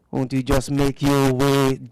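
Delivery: usual speaking voice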